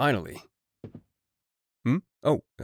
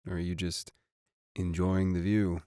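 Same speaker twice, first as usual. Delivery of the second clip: flirty; 6 semitones lower, 7 dB quieter than usual. Acted. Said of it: "doesn't mean you get to flirt with my assistant".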